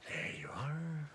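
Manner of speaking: slow whispered there you are; hushed and fond. Real, not acted. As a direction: breathe it to the little model. Whispered to the model.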